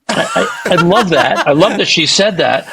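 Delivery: laughing through the words; amused, laughing delivery. Real, not acted. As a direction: chuckle as you say it. Laughing about [name] remark.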